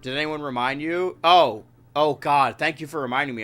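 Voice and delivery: ordinary delivery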